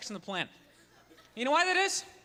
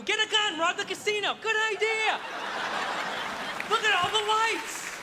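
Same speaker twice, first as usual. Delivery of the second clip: gullible tourist; 8 semitones higher than usual. Retold or imitated. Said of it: "You're like".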